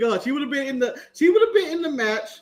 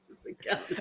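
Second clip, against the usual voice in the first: whispered; 16 dB quieter than usual. Shown as a demonstration. Repeating insistent point.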